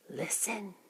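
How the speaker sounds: hushed, secretive whisper. A narrator's reading. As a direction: whisper to get attention discreetly. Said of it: "the man whispered".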